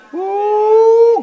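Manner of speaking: long high "ooh"; high-pitched exultant church whoop; praise whoop. Real, not acted.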